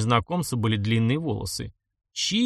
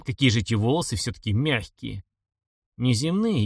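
ordinary delivery, nothing marked

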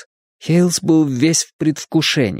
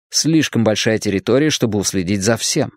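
ordinary delivery

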